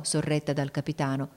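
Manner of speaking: ordinary delivery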